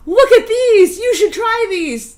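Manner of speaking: high, loud showing-off squeal; overexcited. Pretend. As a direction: pretend to push them on everyone. Hypothetical enthusiastic offering.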